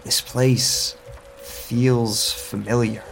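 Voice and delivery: normal talking voice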